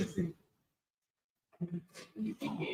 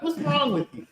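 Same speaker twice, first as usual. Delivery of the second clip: mock chiding; 9 semitones higher, 4 dB quieter than usual. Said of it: "Like, he's chiding them".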